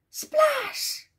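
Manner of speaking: high-pitched storybook exclamation; high, playful sound-effect word; exclaimed splash. A narrator's reading.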